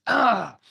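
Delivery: loud, high yelp of defeat; mock outraged cry. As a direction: burst out in a mock wail. Enemy reacts to the right answer.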